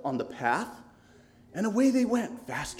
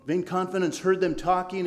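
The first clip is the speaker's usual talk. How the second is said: ordinary delivery, nothing marked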